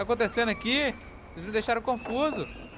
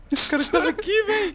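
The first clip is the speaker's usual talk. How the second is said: higher, louder, alarmed laughing call; excited alarm; 12 semitones higher, 5 dB louder than usual. Real, not acted. To friends, spotting the killer close by, excited alarm. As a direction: blurt it out, startled and amused.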